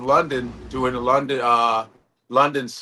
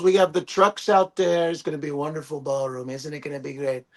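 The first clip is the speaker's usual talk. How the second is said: lightly voiced Trump. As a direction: lightly imitate a boastful president. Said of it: "He goes".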